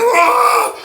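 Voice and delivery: very high mock shriek; imitated pain scream. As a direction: wail like a man pinned down. Imitates Johnson screaming under knee.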